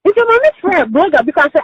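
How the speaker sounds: ordinary delivery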